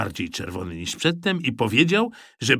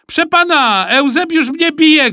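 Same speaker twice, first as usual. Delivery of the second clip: high, loud tattling cry; shouting; 9 dB louder than usual. A narrator's reading. Boy reporting he is being hit, shouting. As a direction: yell to the teacher for help. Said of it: "krzyknął Gottfried".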